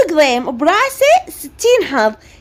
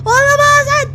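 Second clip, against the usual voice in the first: put-on much higher, wailing child in a sibling fight; a bratty boy bawling and tattling to mum; crying, shouting son; 10 semitones higher than usual. Son refuses the car.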